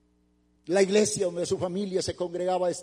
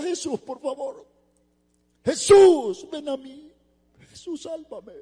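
retold desperate plea; 8 semitones higher, 9 dB louder, slower than usual. Man in hell begging Jesus to save him, desperate high cry. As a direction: call out to be saved, voice thinning high. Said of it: "Dice que le gritaba y le decía".

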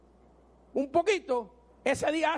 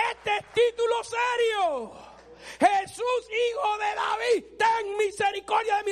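slow, high shouted plea; preacher's cry; 4 semitones higher, slower than usual. Acted. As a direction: cry out for mercy to Jesus. Preaching climax, crying out. (clamor del predicador, lento y agudo)

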